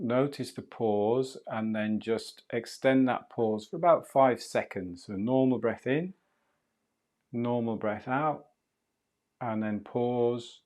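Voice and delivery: calm, unhurried meditation guide voice; soothing breathwork guide talking a student through the breath; guided breathing meditation. Acted. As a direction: ease the listener through each breath, gently.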